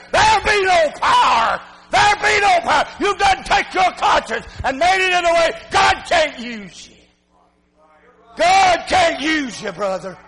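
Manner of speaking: shouted, high, loud revival preaching; fiery pulpit shouting; full-force preaching. Acted. Preacher climbs again.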